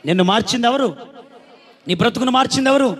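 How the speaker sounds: ordinary delivery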